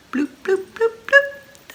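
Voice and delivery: high popping bloop noises; car door sounds. Shown as a demonstration. Storyteller making car door noises for kids. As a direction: pop out each bloop like a car door shutting. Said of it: "Those are the doors shutting".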